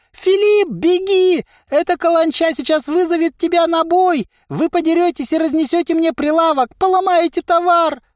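very high, loud frantic voice; squealing merchant's alarm; panicked warning. A narrator's reading. Merchant panics about fight and stall.

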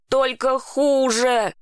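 understated croak of pain; mild croak. A narrator's reading. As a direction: drag it out with a touch of hoarseness. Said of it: "прохрипел я".

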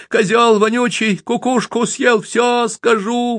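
put-on high slow wailing boy; boy howling so his mother hears. A narrator's reading. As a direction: wail it for the whole street. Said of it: "выл Яшка на всю улицу".

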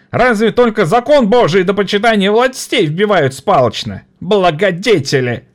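high, bitter tirade; angry young worker. Acted. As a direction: scorn the benefactors. (злой молодой рабочий)

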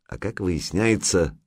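usual speaking voice

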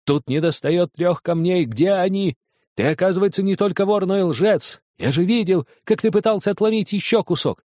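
angry accusation. Acted. Father's furious accusation.

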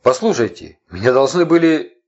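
loud, high desperate call; shouting. A narrator's reading. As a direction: plead loudly upwards. Prisoner shouting up to guards.

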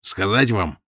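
ordinary delivery

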